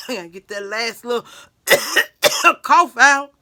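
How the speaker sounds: loud hacking cough. Real, not acted. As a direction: hack out the last bit. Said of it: "still choking a little bit".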